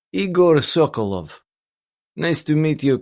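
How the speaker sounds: put-on thick Russian accent, formidable; heavy Russian accent. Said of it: "A heavy Russian accent came over the line".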